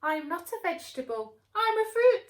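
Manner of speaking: loud, rising to high pedantic voice; high loud correction; know-it-all tomato. Acted. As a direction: correct them proudly. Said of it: "said Tomato".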